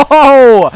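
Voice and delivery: loud, high laughing "oh"; big real burst of delight; delighted whoop. Real, not acted. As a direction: whoop with gleeful surprise. Delighted by the move in game.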